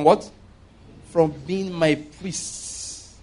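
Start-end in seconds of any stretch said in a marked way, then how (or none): 1.1-3.1 "priests" drawn out slowly at the end; slow right down and stretch the word "priests"; soft, very slow phrase, lingering on "priests"; emphasis on priests. Shown as a demonstration. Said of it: "You notice the emphasis on the".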